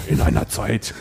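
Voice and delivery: lightly voiced movie trailer announcer; a hint of trailer drama. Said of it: "diesen Film-Trailer-Stimmen". (angedeutete Film-Trailer-Stimme)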